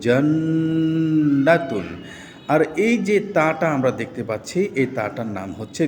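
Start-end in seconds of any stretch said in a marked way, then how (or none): 0.1-1.9 জান্নাতুন said slowly with the doubled ন hummed long through the nose; ghunnah demo. Shown as a demonstration. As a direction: hold the nasal ন in জান্নাতুন. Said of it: "যার কারণে এখানে গুণনা হবে".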